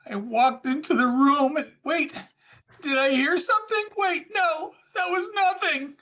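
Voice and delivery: mock scared. [name] performing the scared line for the host, hammed-up. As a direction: ham up a creeping fright. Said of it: "What do you think?".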